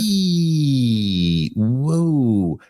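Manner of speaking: long drawn-out "eee" sliding down, then "whoa"; recoiling in disgust. Retold or imitated. Comic retelling of pulling away from her, recoiling in disgust. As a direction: back away as if from something toxic. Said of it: "as if I just realized that I was touching something toxic".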